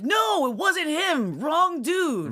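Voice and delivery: spelling the mistake out in a fluster; flustered correction. Retold or imitated. Calling husband back about the wrong death, flustered. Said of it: "had to call back and be like".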